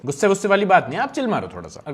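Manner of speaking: usual speaking voice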